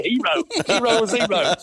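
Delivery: laughing through a self-mocking thought; amused self-mockery. Real, not acted. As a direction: chuckle it out at yourself. Laughing at his own thought.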